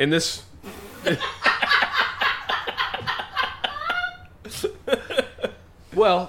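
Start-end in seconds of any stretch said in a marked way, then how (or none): 0.6-4.1 long, breathy high-pitched laughing fit; real helpless laugh. Real, not acted. Telling Hector story to co-host, long breathy laugh. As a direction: crack up and keep laughing.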